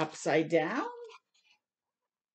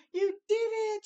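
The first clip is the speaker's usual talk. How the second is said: high, louder cheer; excited shout; 12 semitones higher, 3 dB louder than usual. Acted. Olive cheering Hoot for his garden, excited shout. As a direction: cheer him on with joy. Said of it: "shouted Olive".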